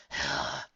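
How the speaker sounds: low, weary sigh; exasperated sigh. Acted. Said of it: "sighed Raindrops with exasperation".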